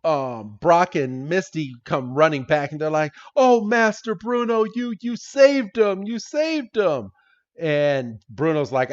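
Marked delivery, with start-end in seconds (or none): 3.3-7.1 high-pitched imitation of adoring fans; mock grateful gushing. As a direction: gush in a put-on high voice, stretching the end. Brock and Misty gush at rescuer.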